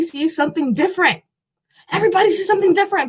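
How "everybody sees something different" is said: higher and much faster, tumbling out; quick excited repeat. Real, not acted. Repeating her point to viewers, fast and high with excitement. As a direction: rush the repeat, pitched up with glee. Repeats the phrase right after.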